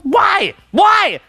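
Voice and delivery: high-pitched wail of bafflement; exasperated why. Acted. Acting out his bafflement at her fans, high exasperated wail. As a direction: cry it out high, hammed-up exasperation. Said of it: "Like this".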